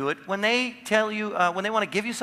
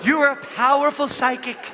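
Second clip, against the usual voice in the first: higher, louder mystic proclamation; mock dramatic; 7 semitones higher, 5 dB louder than usual. Shown as a demonstration. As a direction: proclaim it like a grand psychic, laughing. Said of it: "just do this".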